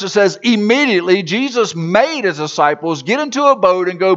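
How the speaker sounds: full-voiced sermon narration; preaching. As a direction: preach the story out to the hall. Sermon storytelling at full force.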